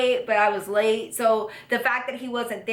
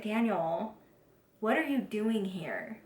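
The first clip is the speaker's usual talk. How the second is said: hushed, puzzled retelling; quiet disbelief; 7 dB quieter, slower than usual. Retold or imitated. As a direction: ask it gently, with a pause after the name. Confronts old flame in retelling.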